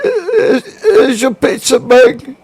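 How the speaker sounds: high, put-on old English actor voice; a theatrical Patrick Stewart attempt at a Mexican accent; hammed-up stately English voice; Patrick Stewart doing Mexican accent. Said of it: "do it as old Patrick Stewart".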